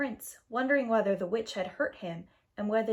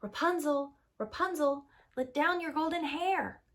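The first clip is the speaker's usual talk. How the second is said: higher, called up the tower; calling up; 6 semitones higher than usual. A narrator's reading. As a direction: call up to her window. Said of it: "called out".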